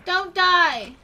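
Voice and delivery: loud, distressed pleading cry; panicked shout. Real, not acted. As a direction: shout desperately to save a pet. Axolotl is in danger.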